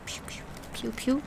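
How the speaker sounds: soft whispered gun noises; whispered pew pew. Pretend. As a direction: breathe the pew sounds. Whispered imitated gunshots.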